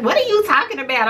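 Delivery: high, quick cousin impression; imitating cousin. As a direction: mimic your cousin's question, high and fast. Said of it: "every time I try to imitate her".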